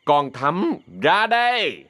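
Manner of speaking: high, drawn-out summoning call; calling out loudly. Acted. Má Bảy calls Thắm out from inside, calling out loudly. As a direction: call her out from the back. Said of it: "kêu to".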